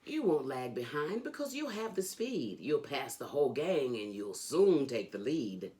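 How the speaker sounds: ordinary delivery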